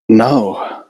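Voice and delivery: ordinary delivery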